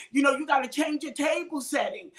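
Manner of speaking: usual speaking voice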